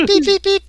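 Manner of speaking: squeaky high beep beep beep. Acted. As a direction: imitate a communicator beeping high. Communicator beep imitation.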